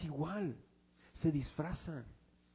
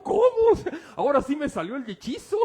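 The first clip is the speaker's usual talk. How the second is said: shocked shriek, much higher and louder; startled medium; 10 semitones higher, 11 dB louder than usual. Retold or imitated. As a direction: cry out as if the spell finally worked. (la médium asustada grita sorprendida, mucho más aguda y fuerte)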